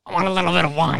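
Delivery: a hint of a sloppy drunk teen asking for wine; imitating his drunk self. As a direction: replay the drunk request the way he once asked. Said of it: "He said it like that".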